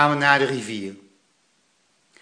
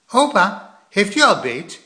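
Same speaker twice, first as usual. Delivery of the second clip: put-on impatient boy; 5 semitones higher, 3 dB louder than usual. A narrator's reading. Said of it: "vroeg Plop al snel".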